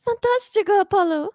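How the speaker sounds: loud, high triumphant cheer; excited cheer. Acted. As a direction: burst out in triumph. Voicing a game character's cheer.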